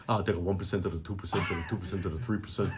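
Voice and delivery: low, fast, hammed-up Bernie Sanders rant; Bernie Sanders impression. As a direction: bark numbers like Bernie on the stump. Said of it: "a very nice impression of that Bernie Sanders".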